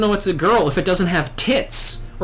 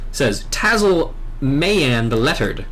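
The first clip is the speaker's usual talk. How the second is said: normal talking voice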